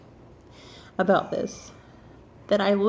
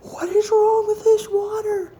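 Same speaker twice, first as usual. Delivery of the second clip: very high, wailing question; hammed-up high-pitched alarm; freaking out; 12 semitones higher, 4 dB louder than usual. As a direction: cry it out in mock panic. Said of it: "I'm freaking out".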